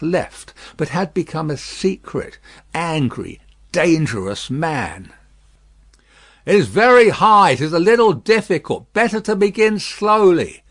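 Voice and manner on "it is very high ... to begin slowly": firm and raised, a stern warning. Acted. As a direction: warn him sternly, no nonsense. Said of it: "in a stern voice".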